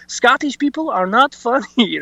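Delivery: mock an offended Frenchman's accent, drawn out; French accent. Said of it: "That's my French impression".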